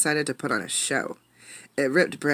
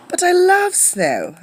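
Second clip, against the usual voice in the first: put-on high, slow, eager little-girl plea; insisting; 7 dB louder, slower than usual. Irene insists to her mother, high eager child voice. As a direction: stretch it out, child begging to go. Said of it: "Irene insisted".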